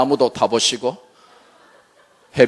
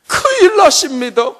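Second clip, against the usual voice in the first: 큰일 shouted much higher and louder; desperate shout; 12 semitones higher, 5 dB louder than usual. Retold or imitated. Mother crying to husband, desperate shout. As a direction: scream 큰일 in despair. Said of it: "큰일 났잖아요".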